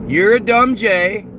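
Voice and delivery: ordinary talk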